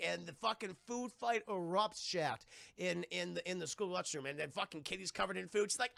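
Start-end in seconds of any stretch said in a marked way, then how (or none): none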